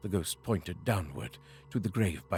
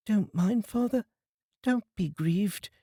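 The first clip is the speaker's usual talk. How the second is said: put-on comforting children; 11 semitones higher than usual. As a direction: soothe your sad father like a small child. Children comfort their father.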